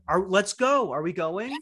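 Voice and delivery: hint of a dad hurrying everyone along; lightly imitated dad. Son imitates dad coming downstairs after a long wait, mock impatience. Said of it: "he'll come downstairs and be like".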